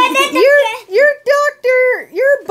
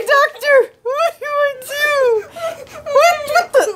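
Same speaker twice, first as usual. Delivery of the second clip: laughing puppet voice; 4 semitones higher than usual. Pretend. Elmo showing the doctor his leg, laughing. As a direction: giggle through it as Elmo.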